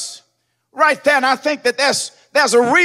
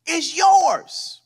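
drawn-out pulpit declaration; slow preached emphasis; 2 semitones higher, much slower than usual. Acted. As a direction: proclaim it slowly to the church. Father's words drawn out.

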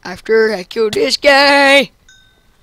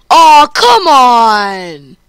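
frustrated groan; 9 dB louder, much slower than usual. Real, not acted. Frustrated complaint.

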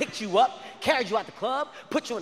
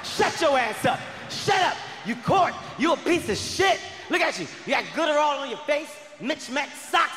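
put-on high-pitched scolding girlfriend; hammed-up indignant telling-off; mock tirade; 5 semitones higher than usual. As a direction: fire off insults in a raised pitch. Acted girlfriend's tirade at her man.

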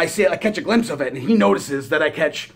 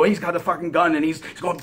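ordinary delivery

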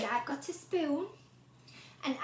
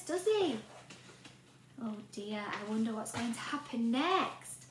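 plain reading voice

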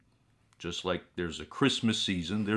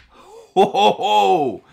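big theatrical ho ho ho; booming laugh; 10 semitones higher, 11 dB louder, much slower than usual. Acted. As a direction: laugh out loud like a gleeful ghoul. Bursts out laughing at the card.

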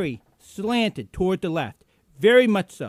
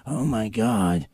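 mocking impression; 7 semitones lower than usual. Mocking the TV host's reaction.

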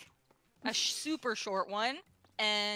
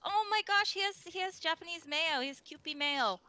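high, gushing amazement; thrilled, pitched well above usual; delighted excitement; 7 semitones higher than usual. Real, not acted. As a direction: gush over an adorable discovery. Gushing over the cute drawing.

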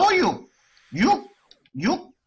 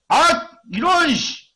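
slow, drawn-out, higher curse; frustrated groan; 6 semitones higher, 4 dB louder, slower than usual. Real, not acted. Streamer cursing a failed enhancement. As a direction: let the failure sting.

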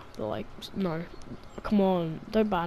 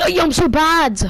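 high, loud self-mocking yell; exasperated shout; 9 semitones higher, 9 dB louder than usual. Real, not acted. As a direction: yell at your own mistake. Dies in game.

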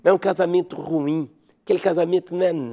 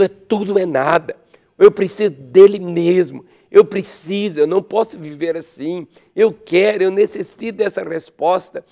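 desperate plea; 4 semitones higher, 6 dB louder than usual. Acted.

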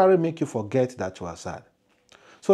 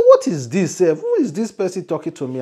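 startled; 5 semitones higher, 6 dB louder than usual. Acted. Shocked reply to the voice.

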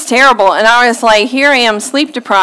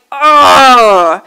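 annoyed groan; 4 dB louder than usual. Retold or imitated. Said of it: "I was just like".

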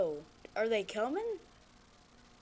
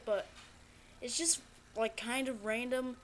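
normal talking voice

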